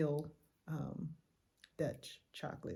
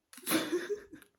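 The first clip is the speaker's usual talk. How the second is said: sudden high burst of laughter; 4 dB louder than usual. Real, not acted. Vlogger laughs about reckless driver, high and loud. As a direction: laugh up high, caught off guard.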